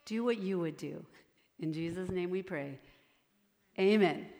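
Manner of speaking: prayer. Acted. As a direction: pray over the children gently. Leads a closing prayer.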